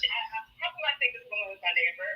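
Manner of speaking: ordinary talk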